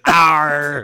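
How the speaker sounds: loud playful pirate arr; pirate growl. Pretend. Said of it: "Why do you sound like a pirate?".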